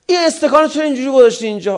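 loud, high, rapid mock scolding; angry nagging. Spouse snapping over a misplaced tea glass, angry. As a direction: scold fast at the top of the voice.

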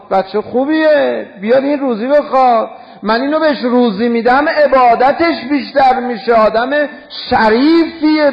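loud, high, ringing proclamation as God; impassioned preaching. Acted. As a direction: proclaim it at full force, pitched high. Preaches at full force as God's voice.

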